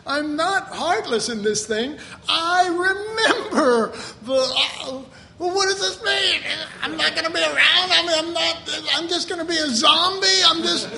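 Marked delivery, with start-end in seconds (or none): 2.3-5.0 laughing. Real, not acted. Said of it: "laugh cry". 5.4-10.9 high, loud, fretful mock complaint; mock panicked whine. Preacher mimicking his old fear of dying to self, high and whiny.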